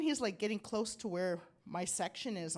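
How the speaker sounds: ordinary talk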